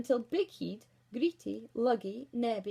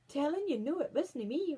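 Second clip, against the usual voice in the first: higher, louder denial; defensive dwarf Luggy; 6 semitones higher, 4 dB louder than usual. Acted. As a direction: protest innocence. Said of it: "replied the dwarf called Luggy".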